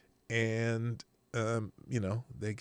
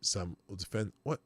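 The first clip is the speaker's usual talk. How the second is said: normal talking voice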